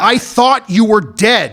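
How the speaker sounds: loud, high, drawn-out protest; heated self-defence. Acted. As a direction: protest loudly, stretching the words. Defends himself in acted quarrel.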